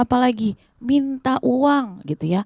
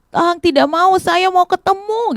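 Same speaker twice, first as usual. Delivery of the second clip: berteriak menolak; 8 semitones higher than usual. Acted. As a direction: refuse to hush, crying out. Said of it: "Bartimeus tetap teriak adik-adik". (seruan ngotot bernada tinggi)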